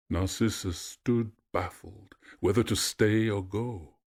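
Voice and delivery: plain reading voice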